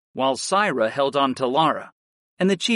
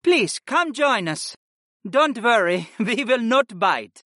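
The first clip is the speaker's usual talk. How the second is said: higher, slow, teasing matron; friendly mother voice; 9 semitones higher than usual. Acted. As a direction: invite them in with a laugh. Retreya invites shy guests.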